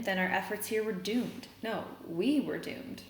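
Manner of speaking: ordinary delivery, nothing marked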